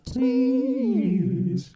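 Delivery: high processed sung word; pitch shifted voice. Shown as a demonstration. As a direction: sing it through a harmonizer. Demo of the effects unit.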